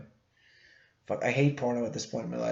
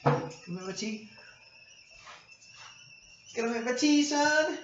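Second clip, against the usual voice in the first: playful high talk; 11 semitones higher than usual. Pretend. Talking to his boiling tea.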